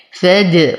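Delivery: فاد with a bouncing echo on final د; qalqalah on dāl. Shown as a demonstration. Teacher demonstrating qalqalah, echoing د at the end of فاد. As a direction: release the د of فاد with a small bounce. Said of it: "وقلقل الدال".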